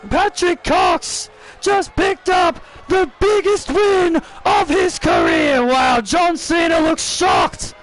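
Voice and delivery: wild celebratory ringside call; hyped play-by-play. Acted. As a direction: scream the upset like a ringside commentator. Live play-by-play of the finish.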